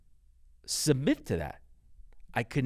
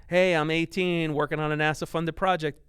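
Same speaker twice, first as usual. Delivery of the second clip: higher-pitched, eager announcement to dad; hopeful kid voice, pitched up; eager son; 5 semitones higher, 4 dB louder than usual. Retold or imitated. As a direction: imitate a kid proudly reporting news.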